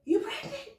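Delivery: very high disbelieving squeak; a hint of his shocked question; mock shocked husband; stunned husband. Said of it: "He's like".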